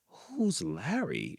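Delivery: slow whispered question; timid whisper. A narrator's reading. Shy rooster whispering to the goose, slow whisper. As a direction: breathe it out slowly, unsure. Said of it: "inquired Clyde".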